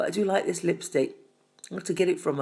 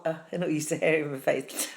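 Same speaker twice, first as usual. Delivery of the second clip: ordinary talk, nothing marked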